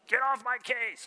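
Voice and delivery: high, put-on exasperated voice; mock-irritated outburst; exasperated mock complaint. Acted message to the congregation.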